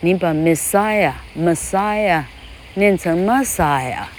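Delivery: "Messiah" said slowly with the stress moved; slow demonstration of the stress shift in "Messiah"; slow stress demo. Shown as a demonstration. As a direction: stretch "Messiah" to show where the accent falls. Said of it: "这个重音".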